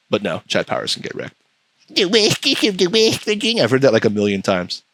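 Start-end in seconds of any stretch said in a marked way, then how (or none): 1.9-3.6 high, grating goofball impression; mocking character voice. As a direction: mimic the silly character way up high. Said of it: "He talks like that".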